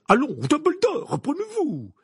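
high, loud remonstrance; urgent protest. A narrator's reading. Minister tells Dumbledore to recover himself, urgently.

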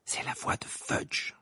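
slow, soft whisper; murmur. A narrator's reading. Woman by the patient's bed names the voice, murmuring. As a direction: murmur it under your breath, drawn out. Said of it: "murmura-t-elle".

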